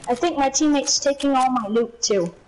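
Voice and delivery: ordinary talk, nothing marked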